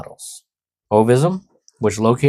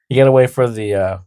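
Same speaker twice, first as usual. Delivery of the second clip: normal talking voice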